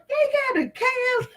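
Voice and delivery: high, delighted surprise; amazed. Retold or imitated. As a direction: squeal it out in disbelief. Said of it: "I was like".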